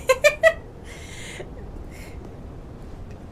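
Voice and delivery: high laugh fading into a breathless wheeze; real, mostly breathy giggle; wheezing laugh. Real, not acted. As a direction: crack up until the breath runs out. Cracks up at own chant.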